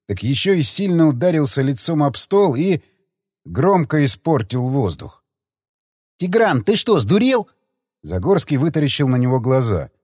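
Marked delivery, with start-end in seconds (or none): 6.2-7.5 higher-pitched startled protest; aghast. Acted. Friend challenging the man who swung the bottle, aghast. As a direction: react in alarmed disbelief.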